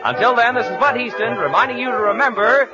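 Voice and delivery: usual speaking voice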